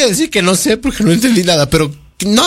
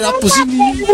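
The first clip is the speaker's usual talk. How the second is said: mock high voice. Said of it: "Dice, dice".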